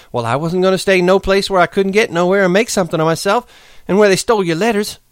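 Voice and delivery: high, rushing dreamy account; eager outpouring. Acted. Curly's wife pours out her past to Lenny, high and eager. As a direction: pour out her dream before he leaves. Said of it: "went on with her story quickly".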